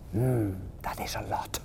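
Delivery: whispered private thought; thinking to himself. Acted. Little hare impressed by his father, whispered thought. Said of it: "thought Little Nut Brown Hare".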